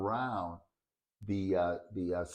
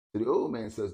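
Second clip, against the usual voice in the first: ordinary talk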